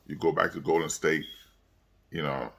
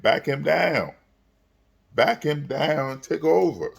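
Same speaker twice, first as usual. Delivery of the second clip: yelling at the TV; 9 semitones higher, 4 dB louder than usual. Retold or imitated. Yelling advice at LeBron on TV.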